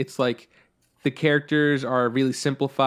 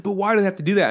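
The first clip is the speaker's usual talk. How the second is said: put-on whiny kid; 7 semitones higher, 4 dB louder, much faster than usual. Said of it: "It's like".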